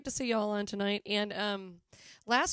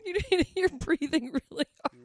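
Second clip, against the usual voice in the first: loud, high, through real laughter; laughing; 8 semitones higher, 7 dB louder than usual. Real, not acted. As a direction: crack up while getting the words out. Cracking up at co-host's heavy breathing.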